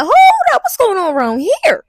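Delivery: high, loud mock surprise; comically alarmed question; startled reaction. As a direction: blurt it high, taken aback. Acted reaction to cops arriving.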